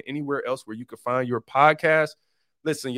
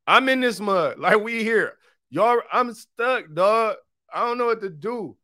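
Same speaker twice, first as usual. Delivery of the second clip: higher, hammed-up helplessness with a laugh; mock distress; 7 semitones higher than usual. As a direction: play at being stuck and helpless. Joking that he is stuck live.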